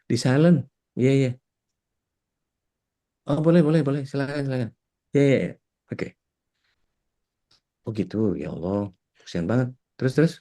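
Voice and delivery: chatty one-sided call, pausing for the imaginary caller; pretend phone call. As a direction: answer a phone call that isn't there. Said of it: "pura punya terima, terima telepon". (pura-pura sedang menerima telepon)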